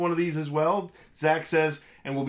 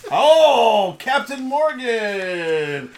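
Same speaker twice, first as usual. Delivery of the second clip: louder, slowly stretched pirate; 6 semitones higher, 6 dB louder, much slower than usual. Acted. Host drawing out the rum's name in a slow pirate voice. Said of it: "See, I went pirate".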